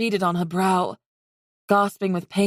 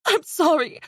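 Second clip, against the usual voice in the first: tearful; 9 semitones higher than usual. Acted. Talon sobbing.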